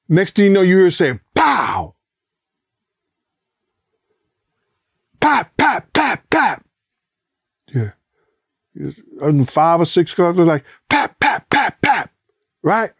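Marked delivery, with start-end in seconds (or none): 1.3-6.6 high, sharp popping gunfire; imitated gunshots. 10.9-12.1 high, sharp popping gunfire; imitated gunshots.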